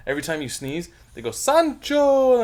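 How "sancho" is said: teasing shout. Retold or imitated. Coworkers shout at sneezer.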